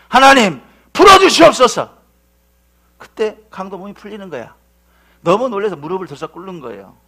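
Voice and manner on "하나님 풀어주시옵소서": sudden loud, high plea to God; acted-out pastor's cry for release; earnest prayer. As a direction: burst out in commanding prayer. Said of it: "하나님께 간절히 기도한 거예요".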